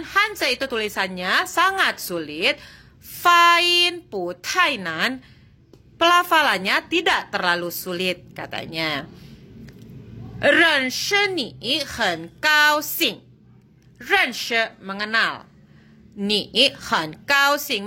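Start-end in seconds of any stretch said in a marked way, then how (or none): 3.0-5.2 Fain pu tai nan drawn out slowly, higher pitch; slow model Mandarin. Shown as a demonstration. Teacher modelling an answer for students, very slow and careful. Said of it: "teacher models Mandarin sentence". 10.4-13.2 Renshi ni hen gao xing said slowly, a little higher; slow model Mandarin. Shown as a demonstration. Teacher modelling a greeting for students, slow and clear. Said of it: "teacher models Mandarin sentence".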